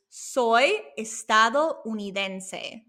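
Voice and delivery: careful, half-speed "soy estadounidense" for learners; slow Spanish model. Shown as a demonstration. Teacher urging learners to use this term, slow demonstration. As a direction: draw out "soy estadounidense" slowly. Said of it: "I stress that you use".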